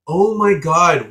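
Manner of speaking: loud, high, drawn-out exasperation; acted-out cry of frustration; exasperated outburst. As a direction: stretch it out, utterly fed up. Acted exasperated reply to professor.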